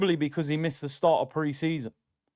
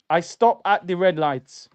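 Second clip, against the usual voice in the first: ordinary talk, nothing marked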